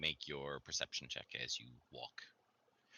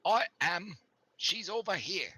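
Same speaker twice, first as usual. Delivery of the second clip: higher, louder cheerful guide; NPC guide voice; 5 dB louder, slower than usual. Acted. The villager guide presents the camp to the party, in a bright raised voice. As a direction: proudly show off the camp.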